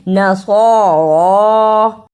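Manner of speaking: "नसरा" very slow, both long vowels held; drawn-out, sustained ending on "नसरा"; elongated vowels. Shown as a demonstration. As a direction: stretch the heavy -सरा of "नसरा", the last vowel longest. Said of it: "इस पे थोड़ी ज्यादा देर लगानी है".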